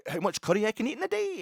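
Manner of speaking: high, hammed-up mock Welsh title; lightly mocked Welsh accent. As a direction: announce it like the Welsh lad's video. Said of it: "I can't do the Welsh accent".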